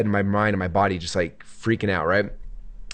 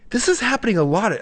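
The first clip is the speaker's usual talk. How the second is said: high-pitched imitation of a worried complaint; retold worry; 11 semitones higher than usual. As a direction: lightly act out nervous pushback. Worried message to calm partner.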